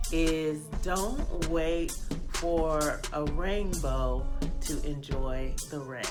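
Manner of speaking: ordinary delivery, nothing marked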